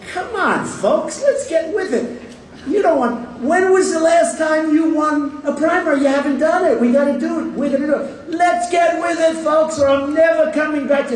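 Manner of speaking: mock pleading rally cry, high and excited; sarcastic. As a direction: ham up a sarcastic pep shout. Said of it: "I'm being sarcastic".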